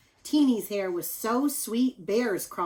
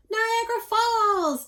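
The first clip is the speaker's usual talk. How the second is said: much higher, louder, slow joyful cry; cry of delight; 7 dB louder, slower than usual. Acted. Teeny under the new waterfall, joyful cry. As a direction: shout it with glee. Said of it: "Teeny cried out in delight".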